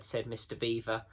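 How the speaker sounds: ordinary delivery, nothing marked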